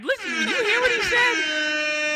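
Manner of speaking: very high, slow, hammed-up preacher cry; preacher shout. Streamer plays a preacher rousing the church, hammed-up shouting cry. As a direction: wail it to the congregation.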